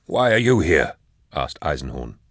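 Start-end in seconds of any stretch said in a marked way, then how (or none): 0.1-1.0 raised, higher-pitched challenge; agitated demand. A narrator's reading. Eisenhorn confronts Cherubael, agitated demand. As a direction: push it out high and urgent.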